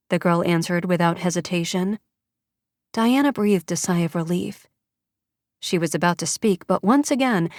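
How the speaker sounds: ordinary delivery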